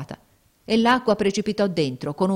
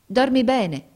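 put-on girl's voice; 7 semitones higher, 5 dB louder, slower than usual. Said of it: "disse Giulietta".

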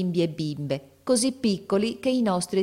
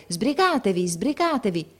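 high, mildly raised urging; understated narrated shout, repeated; calling to hurry; 4 semitones higher than usual. A narrator's reading. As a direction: hurry the children along. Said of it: "a furia di spingere e di gridare".

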